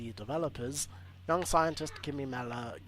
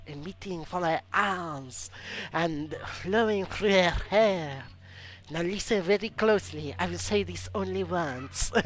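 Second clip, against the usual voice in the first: put-on French accent; 4 semitones higher, 6 dB louder than usual. Streamer reading a hero bio, in a mock French accent. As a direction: play a theatrical French heroine introducing herself. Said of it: "I'm doing this like the French hello, hello voice".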